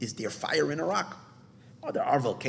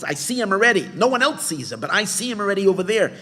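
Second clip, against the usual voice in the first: higher and louder, thrilled to see him; eager proclamation, higher and louder; excited exclamation; 8 semitones higher, 8 dB louder, faster than usual. Retold or imitated. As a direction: burst out with the vision. Seer's vision, exclaimed.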